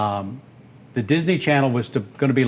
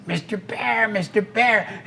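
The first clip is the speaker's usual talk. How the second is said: attempted duck voice; 7 semitones higher, 3 dB quieter, slower than usual. Shown as a demonstration. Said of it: "Mom, listen".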